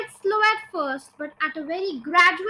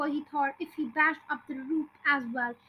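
plain reading voice

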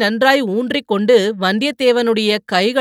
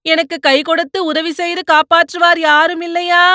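high, loud cry for help from the mud; terrified shout as he sinks in quicksand; panicked shout; 6 semitones higher, 3 dB louder than usual. Acted. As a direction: yell desperately while sinking. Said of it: "என்று கத்தினான்".

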